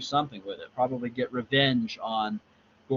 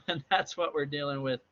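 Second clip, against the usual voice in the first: ordinary delivery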